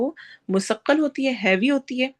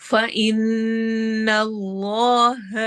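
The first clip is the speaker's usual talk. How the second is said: slow chanted tajweed with a long drawn ha; Quranic recitation; much slower than usual. Shown as a demonstration. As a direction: recite it, stretching the vowel. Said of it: "جلالہ کو ہم heavy پڑھیں گے".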